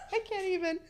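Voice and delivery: laughing too hard to finish, voice cracking high; helpless laughter. Real, not acted. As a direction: collapse into real giggles mid-sentence. Friends recalling a funny trip story.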